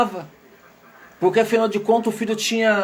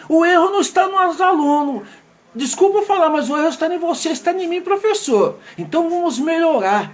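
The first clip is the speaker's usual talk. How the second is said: higher, fervent plea to teachers; impassioned appeal; 6 semitones higher than usual. Real, not acted. Pedagogue urging fellow teachers to take the blame, fervent and higher. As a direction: rise up and push the point home.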